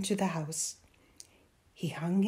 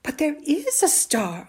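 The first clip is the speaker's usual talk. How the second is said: high, loud insistence; persistent; 7 semitones higher, 7 dB louder than usual. A narrator's reading. Boy contradicts his doubting mother, persistent. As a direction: protest that it's true. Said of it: "Amal persisted".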